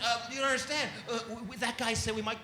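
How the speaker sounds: mock-nervous, halting excuse; flustered pleading. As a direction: plead timidly with a cop. Comic stammers to cop.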